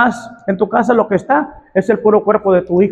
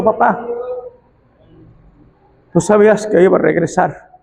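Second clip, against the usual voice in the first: súplica tierna. Acted. Tender voiced son's apology.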